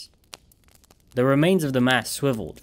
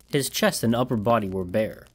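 ordinary delivery, nothing marked

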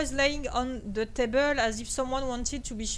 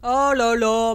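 loud exclamation; 4 semitones lower, 8 dB louder, slower than usual. Real, not acted. Scared in horror game.

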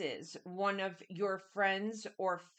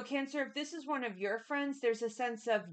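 normal talking voice

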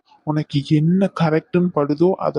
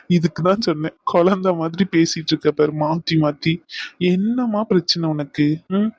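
ordinary talk

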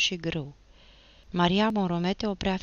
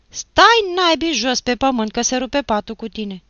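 loud, high irritated order; sharp scolding; 9 semitones higher, 12 dB louder than usual. A narrator's reading. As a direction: stop him before he sits. Aunt scolds nephew off the bed. (răstit, tare și înalt)

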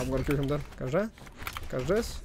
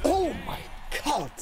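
high burst of disbelief; real excited outburst; excited exclaim; 8 semitones higher than usual. Real, not acted. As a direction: gasp it out at the play. Reacting to a big play.

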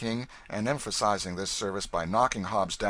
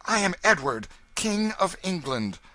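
raised, regal proclamation; princely dignity; 10 semitones higher, 6 dB louder than usual. A narrator's reading. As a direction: announce it as royalty, voice lifted. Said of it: "answered with princely dignity".

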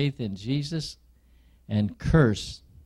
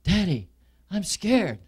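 put-on higher, louder little girl's frightened cry; mock frightened child; 6 semitones higher, 5 dB louder than usual. Daughter calls to her father, a mild tearful fright. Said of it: "In tears, your daughter cries".

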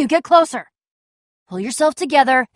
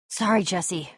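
soft, low apology; regretful apology; 7 semitones lower, 10 dB quieter, slower than usual. Acted. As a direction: say it low and quiet, as a farewell. Apologizing while leaving companion.

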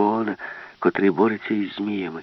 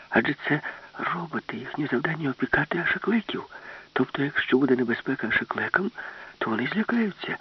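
hushed, higher urgent whisper; speaking quietly; 7 semitones higher, 4 dB quieter than usual. Acted. Alice explains her plan quietly so the ship's brain won't hear. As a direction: explain the plan under the breath. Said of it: "намагалася говорити тихо".

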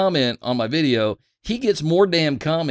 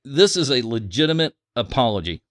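ordinary talk

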